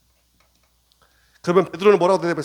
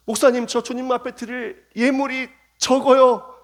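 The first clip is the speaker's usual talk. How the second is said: timid churchgoer; 8 semitones higher, 5 dB louder than usual. Retold or imitated. 성도 목소리 흉내.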